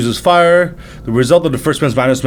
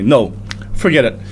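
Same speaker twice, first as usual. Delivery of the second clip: usual speaking voice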